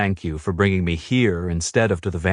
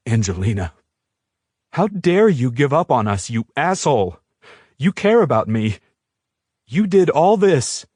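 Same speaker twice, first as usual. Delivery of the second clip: angry outburst; 5 semitones higher than usual. A narrator's reading. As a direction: tear into him for giving up. Heroine confronts Jared angrily.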